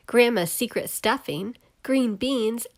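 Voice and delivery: ordinary delivery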